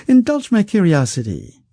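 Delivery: high, smooth, coaxing voice of a wealthy schemer; silky rich man. Acted. Wealthy schemer coaxing the outlaw, high and silky. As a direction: purr it out like a sly patron who holds all the cards.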